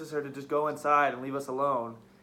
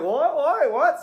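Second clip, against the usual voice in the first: loud, high startled "what"; flustered mimicry; 9 semitones higher, 7 dB louder, much slower than usual. Mocking him fumbling with the radio, flustered and high. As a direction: imitate someone caught fumbling, loud and high.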